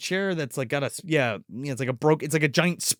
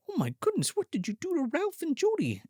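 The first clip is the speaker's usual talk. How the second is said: mock prim old woman; 9 semitones higher, 6 dB quieter than usual. Witch woman voiced, retold.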